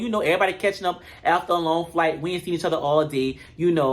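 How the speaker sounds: usual speaking voice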